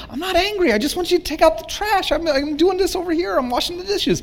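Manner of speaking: high, indignant, insisting he's not angry; defensive denial. Retold or imitated. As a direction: protest that he's only asking about the trash. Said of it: "retelling his defensive reply to wife".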